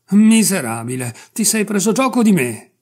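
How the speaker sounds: loud, high furious yell; enraged customer lunges at the barber, shouting. A narrator's reading.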